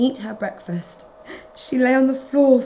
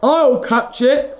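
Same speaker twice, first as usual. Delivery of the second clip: big dog's thought; 3 semitones higher, 4 dB louder, slower than usual. Acted. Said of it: "thought a big dog".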